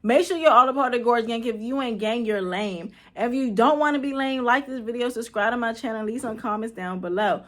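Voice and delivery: loud, bouncy YouTuber hype; hyped intro voice. Acted. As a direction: shout out the channel intro like a host. Hyped channel intro persona.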